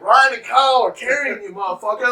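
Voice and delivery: high, loud yell back at him; exasperated shout. Retold or imitated. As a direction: shout the obvious at a confused friend. Yelling back at drugged friend.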